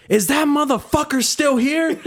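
high, slow mimicking of her voice; high and slow, imitating an angry woman; mock angry lady. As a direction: play her as a high, drawn-out nag. Retelling angry woman asking about customer.

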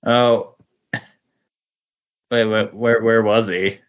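ordinary talk, nothing marked

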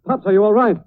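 high, loud worried cry; urgent, frightened shout; alarmed call. Acted. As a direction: call out in alarm to a hurt old man. Alarmed call to injured father.

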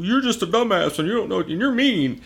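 sulky mock complaint; a hint of a pouting kid; whiny mockery. Mocking the group's complaints.

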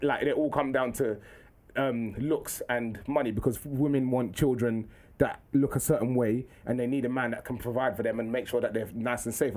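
slow, low lecturing pupil; measured, proper and unhurried; formal schoolboy impression. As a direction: imitate a kid earnestly explaining research. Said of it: "How comes you talk like this?".

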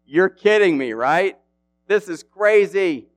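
put-on Goliath scoffing at young David, loud and high; scornful giant. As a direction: sneer at the boy in disbelief. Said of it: "He was like".

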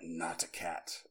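low, quiet, slow mysterious voice; voice in the head. Retold or imitated. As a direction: intone it softly and slowly. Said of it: "heard this voice in my head".

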